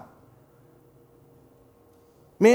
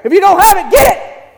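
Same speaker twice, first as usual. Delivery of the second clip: much higher and louder, a rousing call; fervent shout; 7 semitones higher, 6 dB louder than usual. Real, not acted. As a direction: fire them up to get it. Preacher urges the congregation.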